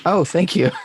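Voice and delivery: laughing. Real, not acted. Flattered by accent compliment.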